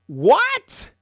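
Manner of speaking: stunned, scoffing what; incredulous what. Real, not acted. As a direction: react as if the claim is absurd. Disbelief at a rumour read out.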